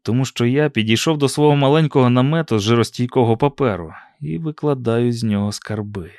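ordinary delivery, nothing marked

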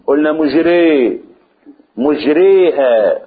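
مجريها with the vowel eased between alif and ya; مجريها, a softened a sliding toward i. Shown as a demonstration. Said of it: "تسهل بين الألف والياء".

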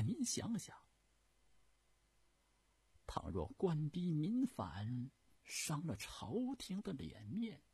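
animated pleading with swooping pitch; earnest eunuch advisor. Acted. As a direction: plead the case humbly and emphatically. Eunuch argues before the Empress. (太监恳切陈词，抑扬顿挫)

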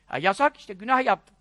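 ordinary delivery

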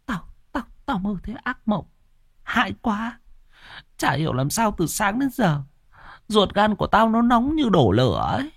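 high, hushed anxious stammer at first; fretful voice just out of a nightmare; shaken, panting. A narrator's reading. Mother waking from a nightmare to her daughter, shaken and anxious. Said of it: "thở hồng hộc".

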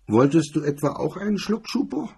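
high, mocking sneer; sneering grin. A narrator's reading. MacDonald mocks the grey-haired cop, high sneering taunt. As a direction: taunt him with a grin you can hear. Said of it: "sagte mit höhnischem Grinsen".

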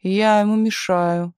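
slow, grand exclamation; mock pathos. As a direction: declaim it with theatrical pathos. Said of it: "с пафосом воскликнул Миша".

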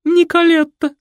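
high, loud flirty answer; coquettish. A narrator's reading. Mother flirting while introducing herself to Fred. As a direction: flirt as you say your name. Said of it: "кокетливо ответила маменька".

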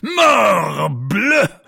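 bellowed French curse; furious oath. A narrator's reading. Captain cursing his musketeers, shouting. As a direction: spit out the oath at full volume.

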